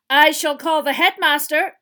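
loud, high, stern shout; shouted threat. Acted. Teacher threatening the poltergeist, stern shout. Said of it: "shouted Missus Professor McGonagall".

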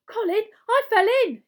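put-on high, loud, shrill little-boy cry. A narrator's reading. New boy tells his brother he fell in, shrill. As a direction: pipe it up shrilly and loudly. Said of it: "he said shrilly".

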